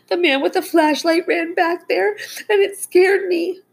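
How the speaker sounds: put-on very high, plaintive little-girl whimper. A narrator's reading. Frightened girl telling her dad, whimpering. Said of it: "she whimpered".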